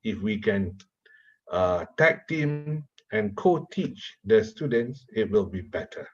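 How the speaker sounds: ordinary talk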